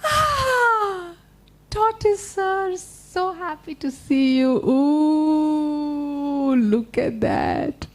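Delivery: flattering spider. Acted.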